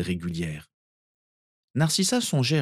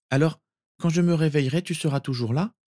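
put-on higher-pitched child's question; small boy seeking reassurance; 8 semitones higher than usual. Sirius asking cousin whether she will stay, hopeful. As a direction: ask like a hopeful little boy.

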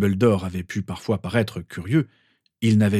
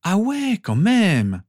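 high, impressed exclamation; admiring wow from a teen; 11 semitones higher, 4 dB louder, much slower than usual. A narrator's reading. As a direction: sound truly impressed, pitched up. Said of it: "fit son double admiratif".

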